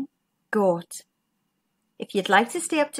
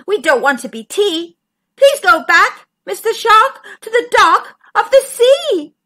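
high, louder plea; crying cod; 6 semitones higher, 7 dB louder than usual. A narrator's reading. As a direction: beg the shark to leave. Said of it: "cried the cod".

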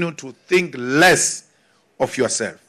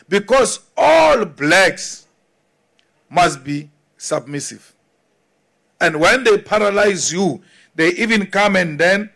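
usual speaking voice

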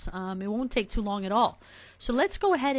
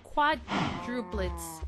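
usual speaking voice

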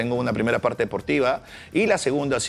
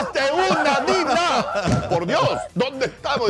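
ranting impression; 10 semitones higher, slower than usual. Host impression ranting at Dina, high outraged cry. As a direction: throw the catchphrase up high. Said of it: "tu imitación".

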